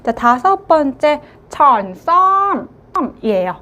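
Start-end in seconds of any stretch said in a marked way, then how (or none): 1.5-2.7 천썸 slow, higher and louder; emphatic model. Shown as a demonstration. Teacher introduces the fifth word, slow and loud. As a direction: present 천썸 slowly and firmly. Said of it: "teacher presents word".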